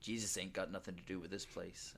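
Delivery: flat, low, unimpressed guard line; deadpan. Retold or imitated. Guard in the movie answering the doctor, deadpan. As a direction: deliver it dead flat and quiet. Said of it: "like, so deadpan".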